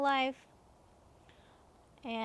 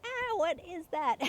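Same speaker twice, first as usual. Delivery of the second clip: a high startled cry at the bugs; freaked-out yelp; 7 semitones higher than usual. Retold or imitated. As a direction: squeal as if bugs cling to your legs. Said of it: "we both freaked out".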